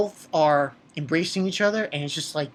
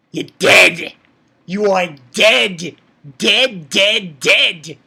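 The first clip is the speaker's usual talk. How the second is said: loud, slow, punched-out threat; acted death threat; 7 dB louder, much slower than usual. Reviewer voicing Asuna's look at Curdle, loud threatening. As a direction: hit each word hard like a mock death sentence.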